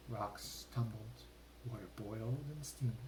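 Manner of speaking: plain reading voice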